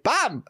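high, punchy "bam"; shouted impact noise. Acted. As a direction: fire off the sound effect. Repeats the blow noise.

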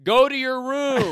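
high, loud, slow scolding parent; angry parent. Acted. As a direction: yell it like a furious parent. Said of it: "here's a good psychopath impression".

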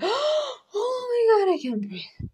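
high, slow, breathless lovestruck swoon; mock swooning. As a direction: gasp it out like you're melting over a crush. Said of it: "you're like".

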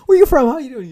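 very high, loud, overexcited questions; mock eager greeting. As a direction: pounce on the newcomer with hammed-up friendliness. Eager newcomers pestering a loner.

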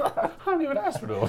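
mock high voice. Echoing friend's line mockingly.

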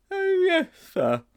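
high-pitched anxious schoolboy; nervous Sponge. Acted. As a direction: squeak out an eager yes to the teacher. Sponge lies to teacher.

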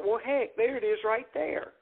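high-pitched "well, heck" discovery; retold sudden realisation. Comic finding his catchphrase on stage, retold surprise. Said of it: "I was like".

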